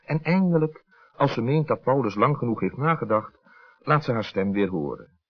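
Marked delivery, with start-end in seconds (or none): none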